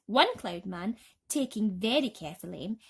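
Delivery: ordinary delivery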